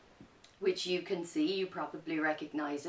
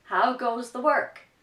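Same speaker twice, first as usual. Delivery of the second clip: loud, higher call; farmer calling; 6 semitones higher, 9 dB louder than usual. Acted. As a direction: nag from bed. Farmer's repeated demand.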